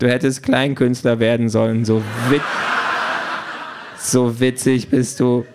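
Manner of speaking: miffed, sarcastic gadget voice; mock them sourly, drawing out the last words. A narrator's reading. Quality Pad tells Pink to take up cabaret, miffed. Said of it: "sagt das Quality Pad eingeschnappt".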